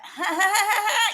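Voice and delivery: high, loud imitation character laugh; Fran Fine laugh. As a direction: put on a big, high mock laugh. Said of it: "it's not enough just to be like".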